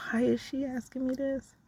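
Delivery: exasperated inner thought. Retold or imitated. Inner exasperated reaction to mother's question.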